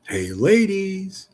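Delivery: high, drawn-out hey ladies; slow crooning hey ladies; slow teasing call. Acted. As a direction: stretch it out like a sly wink. Repeats the teasing call slowly.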